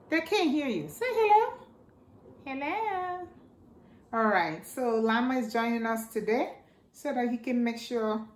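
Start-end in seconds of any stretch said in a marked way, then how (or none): 0.1-1.5 coaxing puppet. Acted. 2.4-3.3 puppet voice. Pretend. Llama puppet answers.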